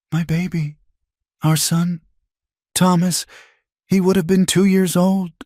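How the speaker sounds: high, tearful, cracking voice; a mother mourning her lost son; voice breaking. A narrator's reading. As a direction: let the grief crack it. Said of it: "Her voice broke".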